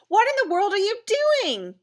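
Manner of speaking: high, loud exasperated outburst; big sister yelling. Acted. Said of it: "yelled my big sister".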